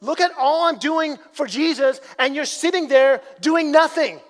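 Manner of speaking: frustrated Martha. Retold or imitated. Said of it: "She's like".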